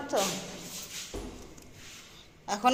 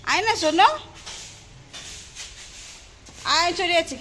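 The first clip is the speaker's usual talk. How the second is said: calling out; 4 semitones higher than usual. Real, not acted. Calling child to come.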